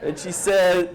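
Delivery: ordinary talk, nothing marked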